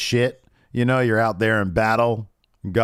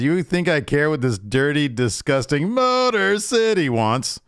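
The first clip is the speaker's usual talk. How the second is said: higher, slow sneering heel taunt; mock sneer; 6 semitones higher, 3 dB louder than usual. Host imitating Sonya taunting the Motor City crowd, slow mock sneer. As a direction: drag out disgusting Motor City with contempt.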